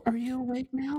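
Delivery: high, playful cooing; cutesy wake-up voice. Pretend. Co-host checks on the waking phone, high cutesy voice. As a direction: ask it high, as to a waking pet.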